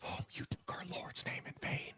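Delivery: hushed, breathy mock whisper of a prude; prissy scold. Mocking people who clutch pearls at swearing, whispered. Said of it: "I'm not one of those".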